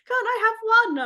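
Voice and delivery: put-on very high little-kid plea; pleading child. Child begging parent for party rings, high mimicked pleading. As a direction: imitate a child begging for a treat. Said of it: "It's just like".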